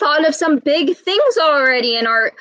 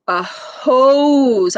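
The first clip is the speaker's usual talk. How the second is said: plain reading voice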